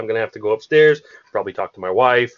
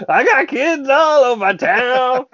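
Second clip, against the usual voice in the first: high, stretched-out character voice; Franklin; 13 semitones higher, 5 dB louder, slower than usual. Acted. Joining in on the bit, high slow Franklin impression. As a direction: draw it out in a high put-on voice. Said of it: "I want Franklin".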